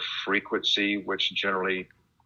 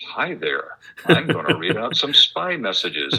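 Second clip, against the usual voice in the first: upbeat announcer imitation; 8 semitones higher, 4 dB louder than usual. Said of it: "He sounds very kind of upbeat and happy".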